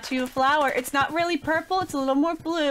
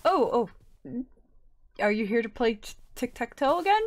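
usual speaking voice

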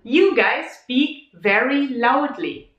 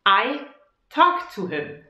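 normal talking voice

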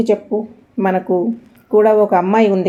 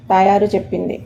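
plain reading voice

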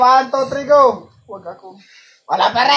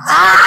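loud, high scream; excited scream; 6 semitones higher, 6 dB louder than usual. Real, not acted. Player reacting as the bottle spin lands, screaming. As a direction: scream out at the result.

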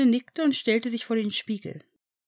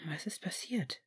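hushed whisper, much softer; quiet whisper; 13 dB quieter than usual. A narrator's reading. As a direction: whisper the question. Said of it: "wollte Ron leise wissen".

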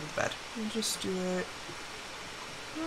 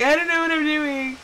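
higher, sing-song helplessness; mock clueless; 4 semitones higher than usual. Gamer comparing herself to smart friend, mock clueless. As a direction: play yourself as lightly lost and clueless. Mimics own clueless self.

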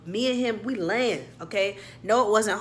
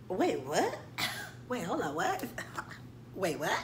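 shocked whisper; 10 dB quieter than usual. Retold or imitated. Stunned disbelief at breakup.

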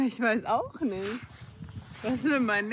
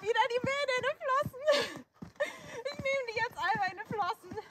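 high yelp; 9 semitones higher than usual. Real, not acted. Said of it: "wieso schreist du?".

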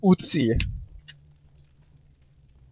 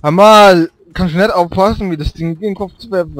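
annoyed scolding; 5 semitones higher, 7 dB louder than usual. Real, not acted. Teammate hit by thrown object.

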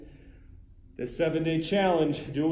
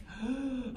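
sharp gasp; 9 semitones higher, 7 dB quieter than usual. Acted. Sudden gasp after whisper.

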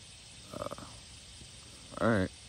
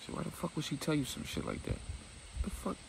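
incredulous whisper; 9 semitones higher, 6 dB quieter than usual. Acted. Incredulous aside.

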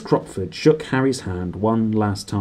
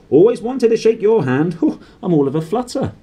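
high, loud flustered gush; 8 semitones higher, 4 dB louder than usual. Acted. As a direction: flutter with delight. Doris gushing, voiced.

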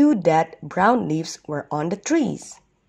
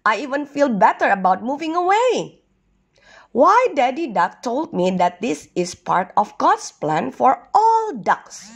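higher storybook voice for a duck; lightly voiced cheered-up duck; 7 semitones higher than usual. Duck shares father's words with turtle, lightly voiced. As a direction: pitch it up, reassured now.

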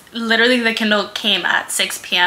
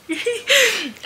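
high excited laugh; 12 semitones higher than usual. Real, not acted. Freaking out over Kindle.

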